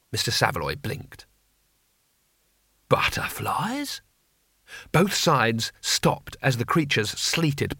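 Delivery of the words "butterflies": a hushed, wondering whisper; breathy surprised whisper. A narrator's reading. Teacher Mister Saveloy sees butterflies, hushed whisper. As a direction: whisper it in disbelief as the swarm passes.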